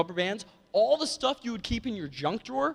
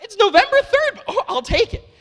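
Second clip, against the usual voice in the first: high, loud, laughing delight; excited laughing; 9 dB louder than usual. Retold or imitated. Kid thrilled the old lady has something for him.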